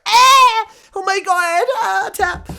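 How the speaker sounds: shrieking high in hammed-up agony, yelling tap; mock pain screams. Retelling getting a leg lock, mock screaming in pain. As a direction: howl like your leg is snapping.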